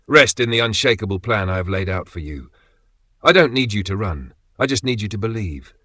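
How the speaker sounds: usual speaking voice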